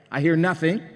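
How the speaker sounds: usual speaking voice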